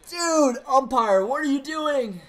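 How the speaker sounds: loud, slow, exasperated yell at the ump; angry at umpire. Real, not acted. Gamer shouting at the umpire over a call, loud and exasperated. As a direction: really shout at the bad call.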